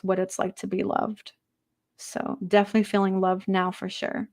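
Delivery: measured medium reading voice; channeled spirit message. Acted. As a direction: speak as the departed, gently.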